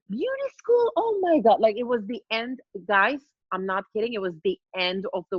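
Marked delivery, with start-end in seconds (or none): 0.1-1.6 high, appalled gasp at beauty school; shocked mom. Retold or imitated. Mom hearing her daughter chose beauty school, appalled and high. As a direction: squeal it like the worst news ever. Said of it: "And she's like".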